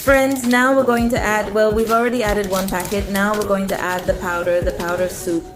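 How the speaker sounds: usual speaking voice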